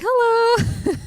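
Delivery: very high, loud lilting hello; sing-song hello. Retold or imitated. Mom stepping through the double doors, high sing-song hello. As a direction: call it out brightly through the doors. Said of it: "I'm like".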